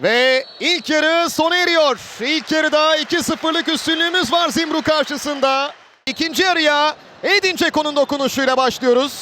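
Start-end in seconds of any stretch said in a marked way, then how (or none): none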